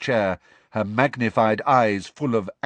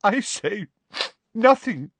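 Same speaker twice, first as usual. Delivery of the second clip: high, halting, choked voice; 12 semitones higher than usual. A narrator's reading. Tearful professor claims to say nothing, choked. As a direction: force it out through angry tears. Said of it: "she choked".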